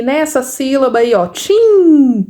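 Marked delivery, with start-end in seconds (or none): 1.3-2.2 TIM held long on the nasal vowel; long, emphatic TIM for learners; drawn-out nasal syllable. Shown as a demonstration. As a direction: stretch TIM so the N is noticed. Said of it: "Perceber aí esse N".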